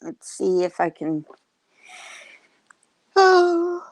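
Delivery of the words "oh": high, loud yawn through the word. Real, not acted. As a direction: say it mid-yawn. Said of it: "sorry, I'm yawning".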